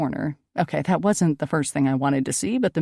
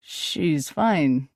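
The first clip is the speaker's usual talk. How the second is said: plain reading voice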